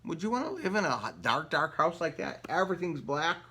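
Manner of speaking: ordinary delivery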